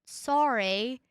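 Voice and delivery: mock-American "sorry" with an open "ah": SAH-ree; American "sorry" attempt. Shown as a demonstration. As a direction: try "sorry" in an American accent, rising at the end. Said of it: "how do you Americans say it?".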